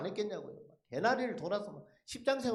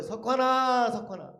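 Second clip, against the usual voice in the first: raised, drawn-out name call, then falling away; calling again from outside; 6 dB louder, slower than usual. Retold or imitated. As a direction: imitate someone calling him from outside. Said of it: "누가 저를 또 부르는 거예요". (밖에서 길게 이름을 부르는 소리)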